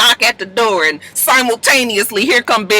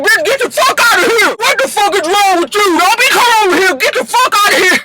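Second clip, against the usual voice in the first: acted angry yelling; 9 semitones higher than usual. Voicing Big Lyrica throwing him out.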